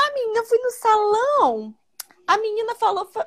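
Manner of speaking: high, airy imitation of a chatty client; imitating the client. Imita a cliente explicando o salão. (imitando a cliente com voz aguda)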